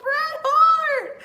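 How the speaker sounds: high, loud gleeful cry, stretched out; excited shout. Real, not acted. Fan laughing about Bret Hart's promo, a high excited shout. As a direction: shout his name in delight. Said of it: "He had me laughing".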